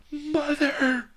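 shrill falsetto shriek of grief; very high screeching bald man crying out. A narrator's reading. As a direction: screech it like a wailing son. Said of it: "he screeched".

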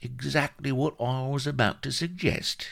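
high-pitched oily agreement; butter him up in a high voice; unctuous. A narrator's reading. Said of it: "said Vladimir unctuously".

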